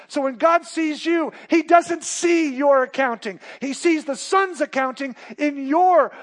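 loud, high, emphatic pulpit voice; preaching hard, lifting every line; full-force preaching. Acted. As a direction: drive it home to the congregation.

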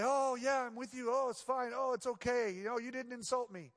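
fake patience. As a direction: put on a bright forced smile. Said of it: "I can put on the face".

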